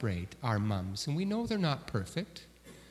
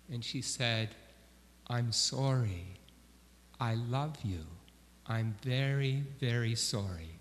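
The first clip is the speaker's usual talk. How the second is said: tender apology; 3 semitones lower, 7 dB quieter than usual. Retold or imitated. Mom holds her son on her knee, soft tender apology. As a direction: comfort him like a sorry mother.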